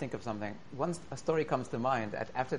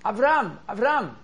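calling out loud; 10 semitones higher, 10 dB louder, slower than usual. Retold or imitated. Boy calling from car window.

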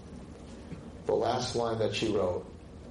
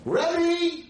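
put-on high, loud shriek of a girl calling out; screamed call; 6 dB louder than usual. Girl calling her rabbi from behind, screamed. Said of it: "girl behind me screamed".

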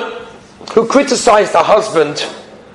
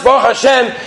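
ordinary delivery

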